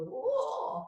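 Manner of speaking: a high flip into a cracked yelp; voice cracking. Shown as a demonstration. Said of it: "That's when you flip and crack and go".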